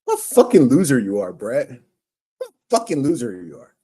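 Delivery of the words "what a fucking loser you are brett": low, louder contempt; loud scornful insult. Real, not acted. Insulting Brett on stream, low and loud with scorn.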